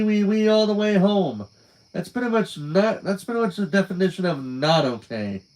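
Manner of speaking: high, exasperated disbelief; incredulous rant. A narrator's reading. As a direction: scoff at the very idea, voice climbing. Narrator mocks Cat Poop's okay.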